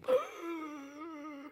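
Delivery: sharp high gasp; gasp of shock. Retold or imitated. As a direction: gasp as if scandalized. Mock shock at the call.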